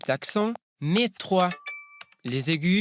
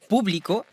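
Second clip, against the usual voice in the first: Publico with the stress on PU; stress on first syllable; 4 semitones higher than usual. Shown as a demonstration. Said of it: "avec accentuation sur le U". (Publico accentué sur la première syllabe, PÚ)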